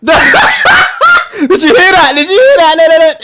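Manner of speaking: loud, high and laughing; can't believe what he heard; laughing excitement. Real, not acted. Laughing disbelief at clip.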